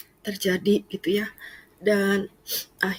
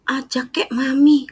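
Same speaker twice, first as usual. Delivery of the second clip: higher, louder imitated mother; mother's plea; 7 semitones higher, 6 dB louder than usual. Mother asks to be taken to church, high and pleading. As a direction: nag like a mom who wants to come along.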